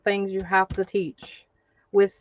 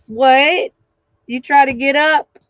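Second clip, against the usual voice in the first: usual speaking voice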